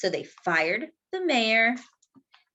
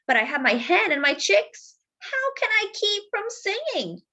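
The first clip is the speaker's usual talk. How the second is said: high, lightly voiced rooster; cheerful rooster; 10 semitones higher than usual. Rooster to the mayor, high and cheerful. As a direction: lift the question up high. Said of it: "said the gallito".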